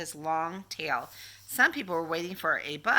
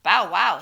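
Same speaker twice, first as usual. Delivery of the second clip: dog bark; 9 dB louder than usual. Acted. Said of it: "said a dog".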